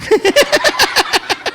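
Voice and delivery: high, open burst of laughter; big laugh. Real, not acted. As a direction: laugh at your own story. Laughing at his story.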